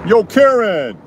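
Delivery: high, loud calling out; Karen call. Shown as a demonstration. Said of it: "That's my Karen call".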